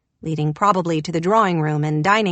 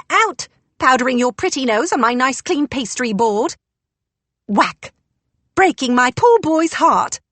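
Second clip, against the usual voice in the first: put-on loud, high scolding old English woman; angry old housekeeper; 5 semitones higher, 4 dB louder than usual. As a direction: chase her off, furious. Angry housekeeper chasing with broom.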